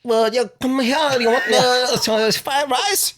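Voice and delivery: put-on very high, drawn-out Vietnamese-accent caricature; hammed-up Vietnamese accent. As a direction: imitate the vendor in a slow, high Vietnamese accent. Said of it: "Vietnamese booth, they're like".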